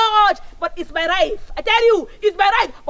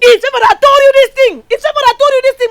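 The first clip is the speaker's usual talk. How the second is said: louder, heated accusation; angry outburst; 5 semitones higher, 8 dB louder than usual. Acted. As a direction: blurt out the blame in anger. Daughter angry at Favor.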